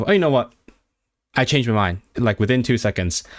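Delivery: usual speaking voice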